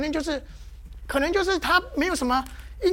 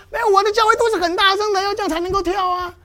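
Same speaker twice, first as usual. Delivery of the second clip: mock loud churchgoer; 6 semitones higher, 7 dB louder than usual. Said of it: "那有的人说".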